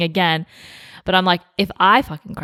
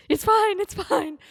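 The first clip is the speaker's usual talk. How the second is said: higher, hurried self-soothing, fading softer; pitched-up nervous reassurance that trails off; flustered it's fine; 14 semitones higher, 4 dB quieter, slower than usual. Retold or imitated. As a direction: bride brushing it off to her sister, higher and flustered. Flustered reassurance in retold scene.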